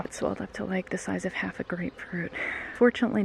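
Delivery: normal talking voice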